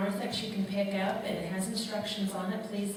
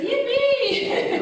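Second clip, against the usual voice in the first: excited yippee; 12 dB louder than usual. Retold or imitated. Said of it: "you notice in there it says".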